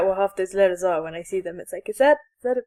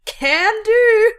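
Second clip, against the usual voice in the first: high, loud cartoon chirp; Mister Meeseeks voice; 10 semitones higher, 5 dB louder than usual. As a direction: chirp it like an eager blue helper. Meeseeks caption voiced in character.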